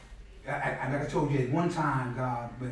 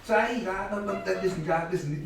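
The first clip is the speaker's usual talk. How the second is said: mock call out; 5 semitones higher, slower than usual. Calling out a name.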